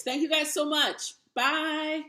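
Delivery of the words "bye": ordinary talk, nothing marked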